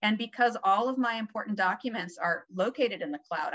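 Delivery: normal talking voice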